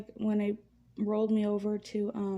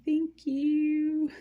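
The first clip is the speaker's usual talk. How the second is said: excited thanks; 6 semitones higher, 5 dB louder than usual. Retold or imitated. Grateful for the cheeseburger.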